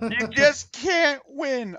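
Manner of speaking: laughing while saying it; laughing high and loud. Real, not acted. Laughing joke about music volume.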